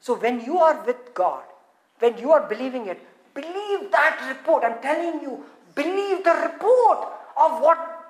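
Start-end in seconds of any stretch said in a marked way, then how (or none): none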